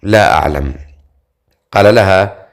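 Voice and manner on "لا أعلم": low, hesitant answer; a touch of uncertainty, pitched low. A narrator's reading. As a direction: answer unsurely, dropping in pitch. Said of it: "ترددت ثم قالت".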